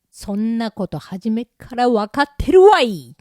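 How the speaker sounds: comic outburst building to a loud end; indignant retort. Acted. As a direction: snap back at obvious advice. Mock retort to friend's advice.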